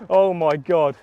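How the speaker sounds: loud breathy awe; overwhelmed by the catch; awed gasp. Real, not acted. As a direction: let the amazement spill out. Landing a personal best bass.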